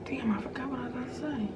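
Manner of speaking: quick unvoiced whisper to yourself; whispered aside. Real, not acted. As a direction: whisper it fast under your breath. Aside after losing her thread.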